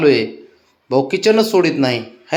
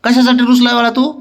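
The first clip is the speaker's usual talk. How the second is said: higher, louder sing-song coax; 9 semitones higher, 5 dB louder than usual. Real, not acted. Owner coaxing the sulking dog in high, louder baby talk. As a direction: lift it up to cheer a sulking pup.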